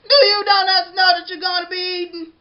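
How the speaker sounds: loud, high call across the bakery; yelling. A narrator's reading. The baker calls out to the other donuts, loud high yell. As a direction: yell it to the whole shop. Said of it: "The baker yelled".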